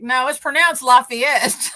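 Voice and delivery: slow, laughing Lafayette said LAH-fee-et; slow, laughing correction, Lafayette as LAH-fee-et. Retold or imitated. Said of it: "And I'm like".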